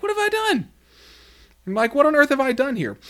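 high, far-off squeaky mock disbelief; incredulous protest. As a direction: play the wronged little brother, bewildered. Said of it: "And David's like".

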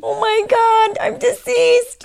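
rising to a gleeful shout; delighted squeal. Real, not acted. Delighted by headline pun.

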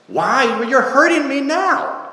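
high, alarmed ghost voice; pained protest. Acted. Ghost complains the angel is hurting him, high and pained. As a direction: protest the hurt in a high pitch.

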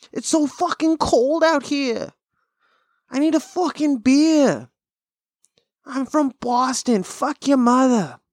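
slow, high mock Boston drunk; Boston accent. Mocking Boston locals, slow high Boston drawl. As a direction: ham up a cold Boston local. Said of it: "Fucking Irish people".

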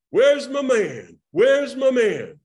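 loud, high, slow call; slow booming call for his man. Retold or imitated. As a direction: boom it out across the room. Sammy calling out loudly.

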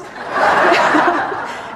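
high, breathy burst of laughter; real, unvoiced laughing fit; open laugh. Real, not acted. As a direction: laugh helplessly at the memory. Laughing at the story.

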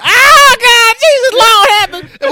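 high, loud hammed-up crying plea; imitating a sobbing mourner, high and loud; mock wailing. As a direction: wail like a mourner at the casket. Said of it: "He said".

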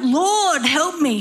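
high, drawn-out plea for help; crying out. Acted. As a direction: cry out to the Lord, begging. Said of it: "and cried out".

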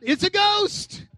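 high, slow, stretched cry of fright; hammed-up terror at a ghost; mock frightened cry. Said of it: "they cried out".

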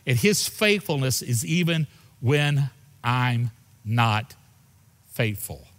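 slow, deliberate, each word weighed; slow it right down and land on faithful; slow emphatic preaching. Acted. Preacher telling the congregation of God's faithfulness, slow and deliberate.

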